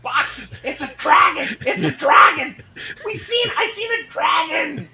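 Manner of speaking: high, loud hammed-up shout to a far-off friend; yelling alarm. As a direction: yell it at the top of your voice. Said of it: "I am yelling at the top of my voice".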